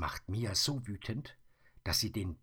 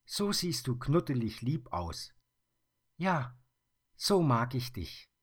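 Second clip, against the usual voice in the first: put-on higher, louder affectionate girl's voice; fond little girl; 8 semitones higher, 5 dB louder than usual. Mia tells the bear she likes him, fond and high. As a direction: coo at the cuddly bear.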